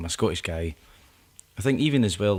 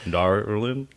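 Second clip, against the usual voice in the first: lightly mocked American country accent; playful American country vowels; 3 semitones higher, 5 dB louder than usual. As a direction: put a touch of country twang on it. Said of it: "country vowels in there".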